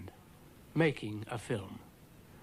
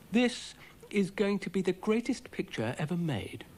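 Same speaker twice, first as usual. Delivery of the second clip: modest; 7 semitones higher than usual. A narrator's reading. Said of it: "said Dougal modestly".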